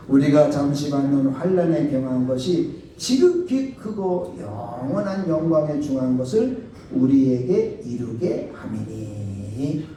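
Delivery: slow, loud unison Bible reading; scripture reading. Acted. Pastor leading the church in reading verse seventeen, slow and loud. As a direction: read the verse together, measured and solemn.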